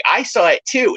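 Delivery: put-on high eager child voice; imitating a kid. Other kids copying, in a high childish voice. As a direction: chime in like a small kid. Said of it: "the other kids are like".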